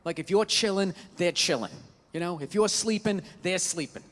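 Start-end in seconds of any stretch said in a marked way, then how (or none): none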